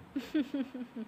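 soft laugh. Acted. Amused at his whimpering.

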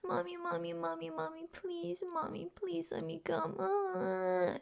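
high, soft, pleading imitation; mocking whiny whimper. Scornfully quoting the overheard listener, mock whimpering. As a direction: mimic someone's whiny begging to shame them. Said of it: "whining and whimpering, going".

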